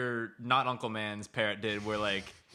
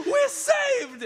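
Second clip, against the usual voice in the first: very high, loud hammed-up cry; mock rejoicing villagers; 7 dB louder than usual. Villagers see their rescuer, hammed-up shrieked relief. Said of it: "all these village people".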